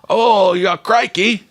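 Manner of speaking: high, hammed-up British accent on crikey; a bad British accent that slips toward Australian; British impression. Shown as a demonstration. Showing off a British impression to the co-hosts, hammed-up British accent. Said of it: "Wanna hear a British impression?".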